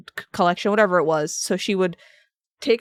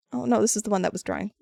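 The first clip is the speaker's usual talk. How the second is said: normal talking voice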